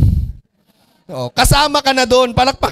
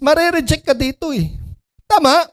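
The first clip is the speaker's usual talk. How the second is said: usual speaking voice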